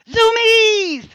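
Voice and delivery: very high, loud scream, held long; high-pitched zoomies shriek; screamed exclamation. Acted. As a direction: scream it out in a sudden burst of frenzy. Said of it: "and she screams".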